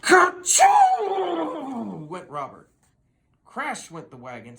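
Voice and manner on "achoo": loud, high ka-choo; explosive horse sneeze; huge sneeze. Acted. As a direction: blast out a giant sneeze. Robert sneezes again.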